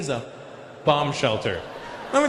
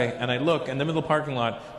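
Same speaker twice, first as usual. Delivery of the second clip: normal talking voice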